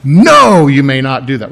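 firm parental ban, leaning on "No"; emphatic no. Acted. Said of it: "You're emphatic".

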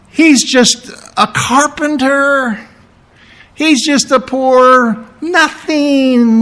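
high, slow, disdainful voice of the priests; sneering mockery. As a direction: look down your nose as you say it. Preacher voicing sneering religious leaders.

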